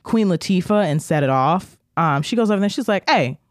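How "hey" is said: high, loud sassy guard call-out; tough security guard. Retold or imitated. Security guard confronting the man in the lobby, loud and sassy. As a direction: call him out across the lobby, much higher and louder.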